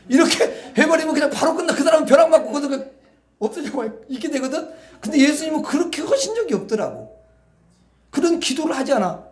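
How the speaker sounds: high, loud laughing delivery; chuckling as the joke lands. Real, not acted. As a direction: laugh through the punchline. Laughing while preaching.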